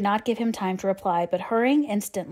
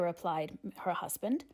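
ordinary delivery

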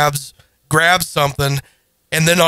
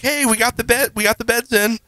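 higher, lightly voiced casual announcement; light imitation, higher-pitched; imitating her; 6 semitones higher, faster than usual. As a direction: pitch it up as someone else's carefree voice. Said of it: "and she's like".

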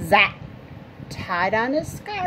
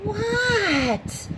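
high drawn-out what; yelling back; 11 semitones higher than usual. Acted. Froggy yells back to his mother, high and drawn out. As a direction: yell back from outside. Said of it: "yelled Froggy".

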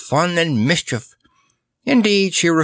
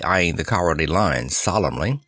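ordinary delivery

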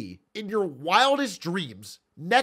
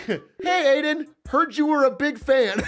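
giggling mock fan message; laughing tease; 7 semitones higher, 4 dB louder than usual. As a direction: tease a friend while cracking up. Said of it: "and I'm like".

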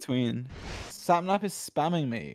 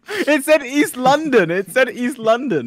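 loud, high, drawn-out insistence; outraged protest; 10 semitones higher, 9 dB louder, slower than usual. Real, not acted. Player protesting the misleading London sign, outraged. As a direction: wail it in loud disbelief.

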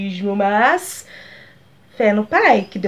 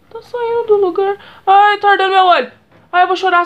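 real distress; 8 semitones higher, 3 dB louder than usual. Real, not acted. Eye burning from glue.

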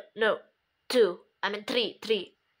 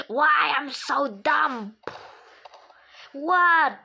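usual speaking voice

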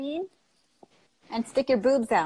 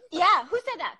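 laughing; 7 semitones higher than usual. Real, not acted. Laughs at comment.

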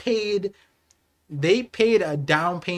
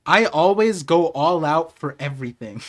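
ordinary talk, nothing marked

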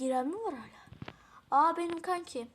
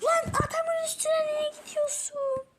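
high, loud cry of disbelief; frustrated yell; 13 semitones higher, 5 dB louder than usual. Real, not acted. Yelling at a teammate who charges the enemy, high exasperated cry. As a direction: shriek at the reckless teammate.

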